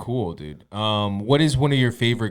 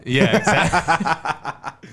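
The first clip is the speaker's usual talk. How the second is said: laughing; 6 semitones higher, 3 dB louder, much slower than usual. Real, not acted. Laughing after the joke.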